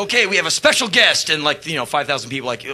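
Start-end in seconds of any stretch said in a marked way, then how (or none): 0.0-1.3 louder, up-tempo stage announcement; mock announcer. Singer announcing the guest to the crowd, mock MC. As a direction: hype the crowd like an MC. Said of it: "and I go".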